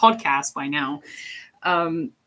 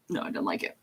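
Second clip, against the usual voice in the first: low, soft, flat repeat of the line; quiet mumbled aside; muttered low repeat; 11 semitones lower, 11 dB quieter, faster than usual. Shown as a demonstration. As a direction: drop the voice way down and mutter it.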